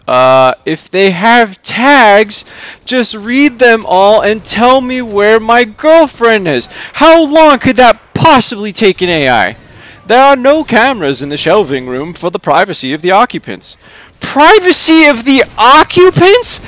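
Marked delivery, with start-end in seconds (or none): 0.1-9.6 higher, insistent arguing; exasperated demand. A narrator's reading. Charlie argues with unhelpful AI. 14.2-16.6 very high, loud incredulous echo; outraged exclamation. A narrator's reading. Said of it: "exclaims Charlie".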